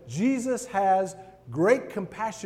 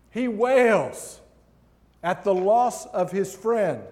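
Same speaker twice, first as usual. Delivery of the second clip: impassioned preaching; 4 semitones higher, 7 dB louder, slower than usual. Acted. Preacher stresses Jesus' grief.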